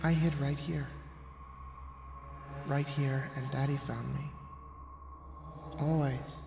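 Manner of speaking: put-on quiet eerie child whisper; ghost child. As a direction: confide a horrid secret like a dead child. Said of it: "a child's voice seeped".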